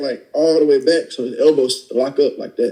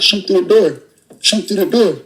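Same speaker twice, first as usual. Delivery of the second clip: higher, forceful commands through a door; barked order; 6 semitones higher than usual. Retold or imitated. Robber on the floor ordering his partner to shoot through the door. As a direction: call out orders to the accomplice, pitch up.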